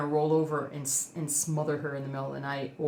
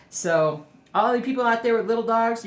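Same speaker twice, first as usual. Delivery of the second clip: usual speaking voice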